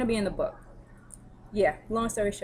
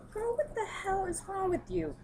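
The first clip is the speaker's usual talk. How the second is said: shocked outburst; 12 semitones higher than usual. Retold or imitated. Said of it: "And I'm like".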